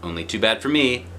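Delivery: ordinary delivery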